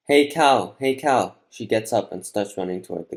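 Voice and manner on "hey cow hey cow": raised, loud call to an animal. A narrator's reading. Girl calling to the wounded cow, loud call. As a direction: call out higher and louder to get its attention.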